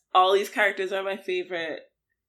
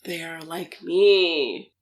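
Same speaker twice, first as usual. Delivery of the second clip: awed gasp; 4 semitones lower, much slower than usual. Retold or imitated.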